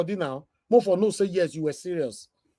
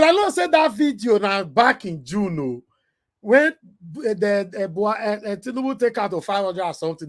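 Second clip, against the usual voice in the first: normal talking voice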